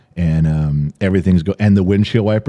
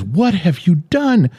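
high, slow, shrill-pitched mock scream; high, slow mock scream of a horrified grandma; retold scream; 10 semitones higher, slower than usual. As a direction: imitate grandma shrieking at the wreck. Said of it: "started screaming".

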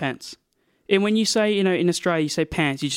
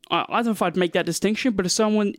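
ordinary delivery

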